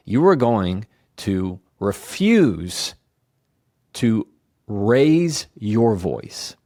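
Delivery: each word laid down slowly and firmly, with a pause before "raise your voice"; slow emphatic rule. Shown as a demonstration. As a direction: lean on "refuse" and "raise your voice", slow and deliberate. Key rule, spelled out slowly.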